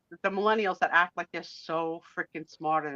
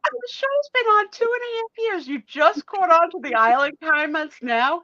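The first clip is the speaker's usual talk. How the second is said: excited teasing; 9 semitones higher, 4 dB louder than usual. Real, not acted. Teasing, laughing exclamation.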